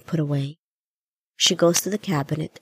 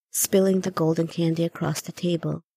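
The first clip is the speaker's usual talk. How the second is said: ordinary delivery, nothing marked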